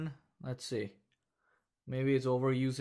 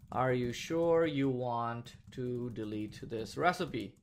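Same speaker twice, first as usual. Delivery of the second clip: dictating; 2 semitones lower than usual. Shown as a demonstration. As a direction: spell it out as you type it. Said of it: "So confirm colon within quotes".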